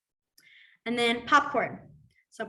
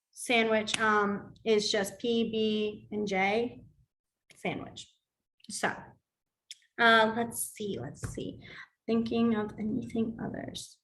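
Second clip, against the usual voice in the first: normal talking voice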